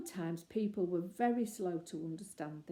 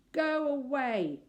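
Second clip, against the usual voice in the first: slow, loud and higher; booming; 7 semitones higher, 8 dB louder, slower than usual. Acted. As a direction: boom it out to send him off. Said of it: "said a booming voice".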